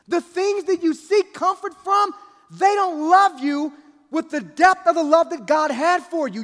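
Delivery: loud, high, impassioned preaching; full-throated sermon climax; preaching at full force. Acted. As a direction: preach it hard, pleading with the congregation.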